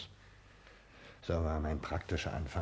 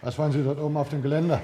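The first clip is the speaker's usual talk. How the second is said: fast, loud and higher; alarmed cry; 9 semitones higher, 8 dB louder, faster than usual. Acted. Doctor shouting to a man on the railing, alarmed. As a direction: call up in alarm.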